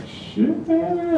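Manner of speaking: squeaky high disbelief, breathy and soft; high exasperated. Retold or imitated. Comic in the checkout line, high-pitched groan of dismay. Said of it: "I'm like".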